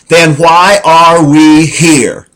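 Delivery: loud, high, slow outburst; wife's loud retort. Retold or imitated. Wife challenging the preacher, loud and high. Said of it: "stood up very quietly and said".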